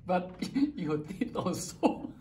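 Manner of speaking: giggling through the apology; laughing. Acted. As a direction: apologise while cracking up.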